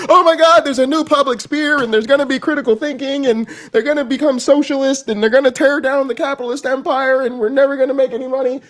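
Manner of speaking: high, loud freaking-out billionaires; mock panic. Billionaires panicking about losing money, mock panic, high and loud. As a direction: panic like rich men losing their grip. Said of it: "at first they was freaking out".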